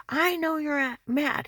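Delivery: high and loud angry yelling; heated office shouting; retold yelling. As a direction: yell it through the door at him. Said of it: "he's yelling".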